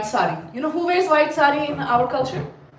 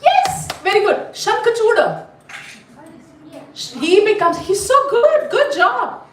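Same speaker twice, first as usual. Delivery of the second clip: real delighted, high-pitched cheer; gleeful congratulation, pitch leaping up; excited praise; 9 semitones higher than usual. Real, not acted. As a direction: burst out high, thrilled at the pupil's answer. Teacher praises a correct answer.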